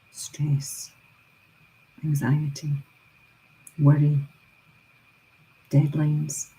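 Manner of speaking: whisper. Acted. Listing things to let go.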